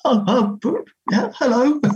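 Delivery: flustered high-pitched hello; voice jumping up. Retold or imitated. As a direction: let the voice jump way up in shock. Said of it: "And my voice".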